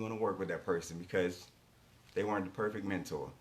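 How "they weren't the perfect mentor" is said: usual speaking voice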